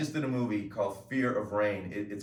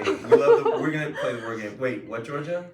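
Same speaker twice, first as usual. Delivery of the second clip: laughing; 3 semitones higher, 8 dB louder than usual. Real, not acted. Laughing with family.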